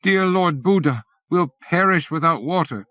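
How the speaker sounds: a lightly voiced old man praying for rain; old man crying out. A narrator's reading. Old refugee begging the heavens for rain, pleading. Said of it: "An old Vietnamese man cries".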